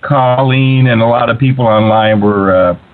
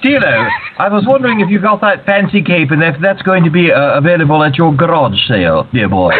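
put-on high, theatrical old-gentleman caller; playfully grand, pitched-up character voice; 6 semitones higher than usual. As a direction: put on a lofty, fussy voice for the host. Guest calls in, playing a character.

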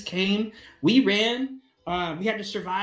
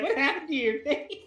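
mock-appalled, higher-pitched question; flabbergasted echo; 8 semitones higher than usual. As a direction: parrot his rude question in disbelief. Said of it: "she's still kind of flabbergasted".

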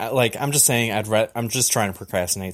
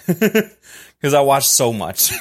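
laughing; 3 semitones higher, 7 dB louder, much slower than usual. Real, not acted. Laughing at own confession.

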